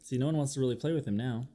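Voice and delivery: usual speaking voice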